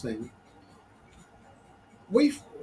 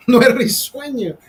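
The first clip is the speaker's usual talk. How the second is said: laughing repeat; 6 semitones higher, 6 dB louder than usual. Shown as a demonstration.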